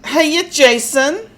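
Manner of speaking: put-on New York Brooklyn accent; bad Brooklyn accent. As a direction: holler it in a hammed-up Bronx accent. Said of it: "not a very good Brooklyn slash Bronx".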